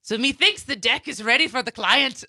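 laughing pirate voice. Shown as a demonstration. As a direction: play a pirate, laughing through it. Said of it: "First, substitute me for I".

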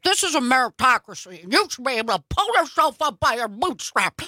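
put-on voice of a conservative preaching self-reliance; conservative impression. Mocking the mantra of conservatism to co-hosts, conservative impression. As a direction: parody someone's conservative uncle reciting his mantra. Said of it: "That was a great impression".

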